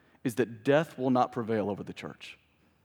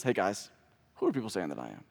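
casual asking; 6 semitones lower, 6 dB quieter, faster than usual. Retold or imitated. Voices Jesus casually asking his disciples.

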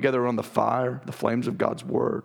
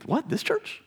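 high, startled "What, this church?"; surprised disbelief; slower than usual. Retold or imitated. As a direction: play the astonished churchgoer. Imagined listener's surprised reply.

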